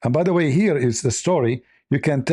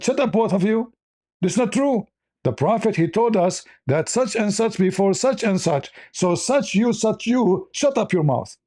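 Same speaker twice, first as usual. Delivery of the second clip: hammed-up telling-off, pitched much higher; mock angry outburst; 10 semitones higher than usual. Imitating the upset companion shouting down the others, in mock anger. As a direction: play the upset man scolding the other two, half in jest. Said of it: "The other guy got upset".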